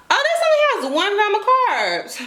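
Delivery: high, pleased squeal of surprise; surprised. Real, not acted. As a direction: gasp at the label in delight. Surprised reading the label.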